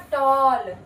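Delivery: "टॉल" with a long aw sound; aw vowel. Shown as a demonstration. Teacher modelling the aw sound in "टॉल". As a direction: round the vowel to aw. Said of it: "ए को ना हम ओ पढ़ देंगे".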